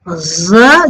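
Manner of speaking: usual speaking voice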